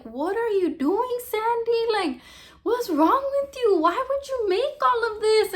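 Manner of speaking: high-pitched, distraught questioning; appalled confrontation. Retold or imitated. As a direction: imitate a friend demanding answers in disbelief. Retells friend confronting her about altar.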